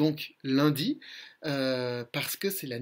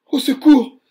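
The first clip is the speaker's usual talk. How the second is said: loud, high panicked cry; a trapped puppet calling out in fear; crying for help; 9 semitones higher, 15 dB louder than usual. Acted. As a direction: scream for help from the cage, much higher and louder. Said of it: "pleure Pinocchio".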